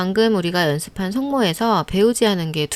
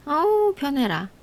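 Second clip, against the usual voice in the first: mock relief; 7 semitones higher than usual. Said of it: "relief after easy sounds".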